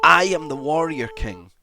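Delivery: triumphant roar of confidence; shouted battle cry. Shown as a demonstration. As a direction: shout it proudly like a warrior. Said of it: "just shout it".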